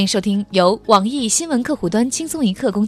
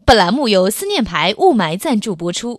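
upbeat sponsor announcement; infomercial; 5 dB louder than usual. Pretend. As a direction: read it like a TV ad. Mock sponsor ad read.

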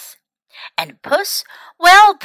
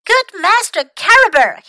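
higher, louder urgent call; excited cry; 10 semitones higher, 5 dB louder than usual. A narrator's reading. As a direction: call out to him in a rush. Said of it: "he cried".

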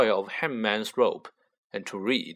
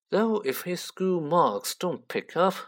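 colder still; 6 semitones higher than usual. A narrator's reading. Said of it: "more coldly still".

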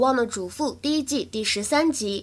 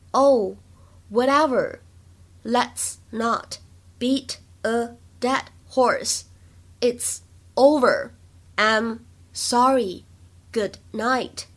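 very slow, word by word with pauses; slow model reading; much slower than usual. Shown as a demonstration. Teacher reading the script line slowly for learners, slow and separated. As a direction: spell the line out slowly, one word at a time.

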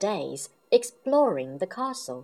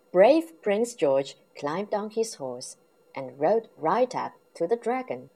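ordinary delivery

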